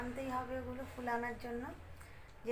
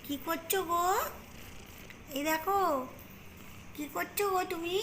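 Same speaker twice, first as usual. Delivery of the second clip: cooing to cat; 4 semitones higher, 5 dB louder than usual. Real, not acted.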